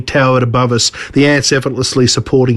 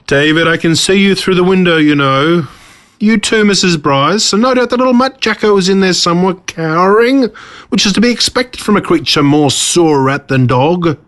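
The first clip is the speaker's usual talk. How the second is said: higher, smug taunting villain voice; 6 semitones higher than usual. Acted. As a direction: sneer down at them like a gloating tyrant. Villain taunts from ant platform.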